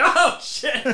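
high, startled yelp; mock shock. Grandson sees grandmother looking like Dracula, mock shock.

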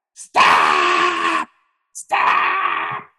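low, drawn-out mock shout; imitated yelling. Teacher halts practice in the middle of dance, imitated yelling. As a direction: yell it as the hotheaded dance teacher. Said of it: "He just kept yelling".

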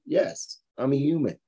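ordinary talk

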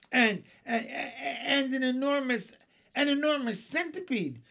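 high, loud, stammering panic; screaming. Acted. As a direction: stutter up into a frantic cry. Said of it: "screamed the captain".

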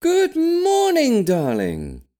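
high, loud, drawn-out sing-song granny greeting; beaming granny voice, stretched high; cheerful granny. A narrator's reading. Granny greeting her grandson at breakfast, high and beaming. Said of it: "Granny beamed cheerfully".

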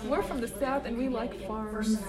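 slow, soft attempt at Southern; Southern accent. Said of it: "we chose Southern accents".

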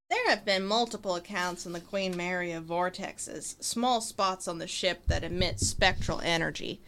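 reading in a lightly put-on Southern accent. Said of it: "Let me find a voice".

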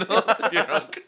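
repeating the joke through laughter; giggling repeat of the punchline; laughing echo. Real, not acted. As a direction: laugh the line back, loud and high. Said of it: "echoing the joke".

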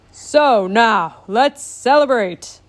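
stern sea king. A narrator's reading. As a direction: give it back with royal weight. King voiced in story.

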